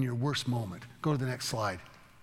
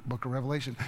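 ordinary delivery

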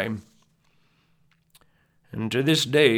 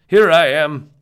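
calling back; 5 semitones higher, 10 dB louder than usual. Acted. Answering a call.

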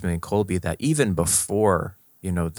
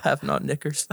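put-on country accent; 8 semitones higher, much slower than usual. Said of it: "in country accents".

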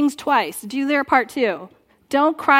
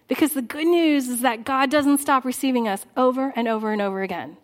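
ordinary delivery

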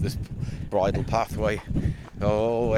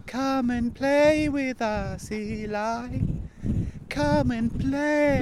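high slow haunting singsong; sing-songy ghost voice; 12 semitones higher, much slower than usual. Acted. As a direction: lure like a spooky child spirit. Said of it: "sing-songy voice".

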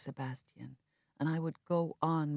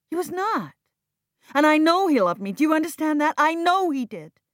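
much higher and louder, near shouting; desperate protest; 13 semitones higher, 14 dB louder than usual. Acted. Viv defending her father against Jack, high and loud. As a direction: insist with all your heart.

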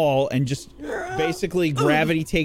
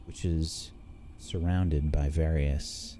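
slow, hushed storyteller read; scene narration; 6 semitones lower, 6 dB quieter, slower than usual. A narrator's reading. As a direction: paint the scene slowly.

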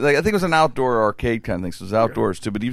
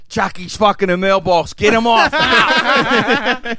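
higher, louder mock yell, laughing; mock yelling bystander; 6 semitones higher, 5 dB louder, slower than usual. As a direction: call out in alarm, half laughing.

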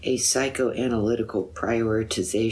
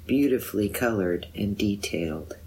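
ordinary delivery

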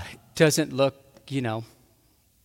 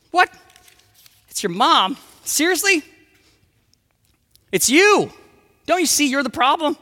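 high, loud exploding outburst; wife snapping at husband, acted shouting fury; furious wife; 10 semitones higher, 6 dB louder than usual. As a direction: blow up at him, he's the problem. Wife erupts in argument.

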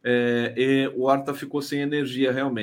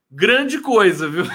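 loud, higher scoffing "Grande coisa"; 5 semitones higher, 8 dB louder, slower than usual. Real, not acted. Mocking a commenter's boast.

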